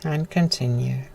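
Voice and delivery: soft, unhurried breath cues; guided breathing. Acted. Yoga teacher calmly guiding the class's breaths. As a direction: ease the class through each breath. Leads breaths over music.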